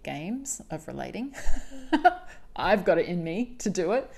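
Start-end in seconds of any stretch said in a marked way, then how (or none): 1.4-2.5 short, high, loud laugh; amused laugh. Real, not acted. Podcaster jokes that relating is an Olympic sport, laughing. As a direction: burst into a quick high laugh. Laughs at her own comparison.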